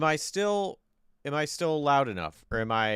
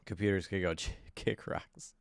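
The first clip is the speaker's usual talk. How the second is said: laughing; 2 semitones lower, 6 dB quieter than usual. Real, not acted. Repeats gripe, laughing.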